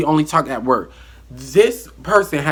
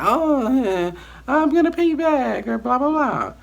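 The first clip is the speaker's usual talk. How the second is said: imitating her nervous laugh, much higher; squeaky, much higher mock voice as she laughs it off; 13 semitones higher than usual. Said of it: "she nervously laughed and was like".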